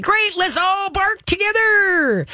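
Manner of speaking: hammed-up excited dog; dog's excited voice. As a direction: voice the dog thrilled to join in. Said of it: "And the dog is like".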